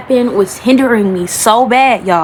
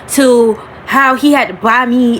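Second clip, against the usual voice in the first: ordinary talk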